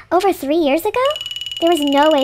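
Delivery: high, loud incredulous question; shocked surprise. Acted. Character reading the last entry's date, shocked.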